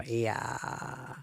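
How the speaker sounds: Yeah in a soft croaky fry; vocal fry. Shown as a demonstration. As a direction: let Yeah fade down into fry. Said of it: "people over fifty don't have vocal fry".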